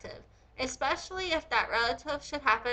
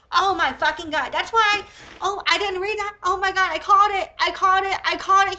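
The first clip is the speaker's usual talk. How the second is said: loud, high, triumphant yelling; excited outburst; 6 semitones higher, 9 dB louder than usual. Real, not acted. Reading a shocking quote, she yells in triumph. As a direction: shout it like you just got proven right. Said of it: "I'm excited that I was right".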